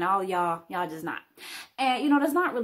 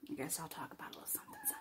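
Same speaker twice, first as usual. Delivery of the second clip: whisper; 9 semitones higher, 20 dB quieter, much faster than usual. Acted. Whispered confessional voice.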